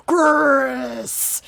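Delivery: lightly voiced villain call; a loud shout in a hint of Wesker. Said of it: "I wish I could do a Wesker voice".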